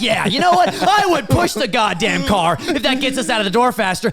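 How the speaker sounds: frazzled rant. Acted. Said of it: "And then I'm like".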